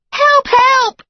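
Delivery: high, loud repeated cry; yelling for help. A narrator's reading. Girlfriend yelling for help again, loud and high. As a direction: keep screaming for help. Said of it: "she yelled".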